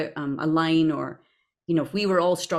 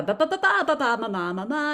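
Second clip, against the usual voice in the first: singsong ba-ba ma-ma voice exercise, swooping higher; vocal warm-up babble; 7 semitones higher than usual. Shown as a demonstration. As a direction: run through a quick actor's vocal warm-up. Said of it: "warm up".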